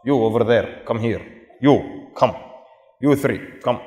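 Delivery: a hint of an imperious sultan; terse mock-sultan commands, picking people out; lightly voiced sultan. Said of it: "This is the Sultan".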